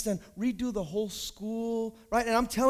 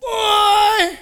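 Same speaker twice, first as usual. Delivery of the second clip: exclaimed shout; 13 semitones higher, 16 dB louder than usual. Real, not acted. Preacher to congregation, a sudden loud cry. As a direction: belt it out at the crowd.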